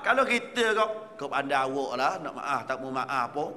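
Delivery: normal talking voice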